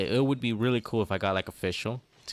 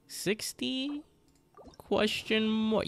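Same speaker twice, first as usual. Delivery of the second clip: baffled squeak; 11 semitones higher, much slower than usual. Real, not acted. Unsure of the pull count.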